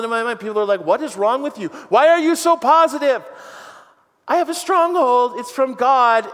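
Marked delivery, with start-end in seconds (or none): 0.8-3.2 mock-baffled, rising high and loud; exasperated disbelief at his positivity; imitating people who can't believe it; incredulous onlookers. Voices baffled people questioning him. 4.3-6.2 high, ringing declaration of faith; elated, emphatic comeback; exuberant reply. Acted. Exuberant answer to the baffled people.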